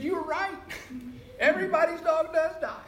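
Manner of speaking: high, loud mock voice for his friend Marty; retold friend. Old friend Marty reminding [name] of a past remark, in a high, loud teasing voice.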